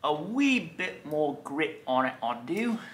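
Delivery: slow, hammed-up mock British accent; bad British accent. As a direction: attempt a British accent and do it badly. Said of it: "I don't know why I did that horrible accent".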